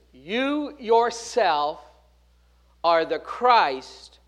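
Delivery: "You yourself" drawn out slowly, stressed on "You"; emphasis on you yourself. Shown as a demonstration. Peter confessing to Jesus, slow emphatic stress. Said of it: "Now notice the emphasis".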